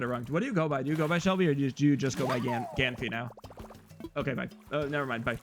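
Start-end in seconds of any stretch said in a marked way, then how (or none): none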